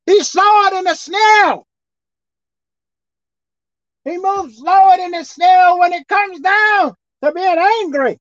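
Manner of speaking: high, loud preacher's cry; preaching shout. Acted. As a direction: hit snail hard for the congregation. Preacher drives home the point.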